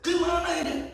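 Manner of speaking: very high, put-on squealing panic; panicked shriek. Traveler screaming back at the owner before the ravine, panicked shriek. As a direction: shriek in terror at the cliff edge. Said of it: "saya dengar kalimat-kalimat yang muncul".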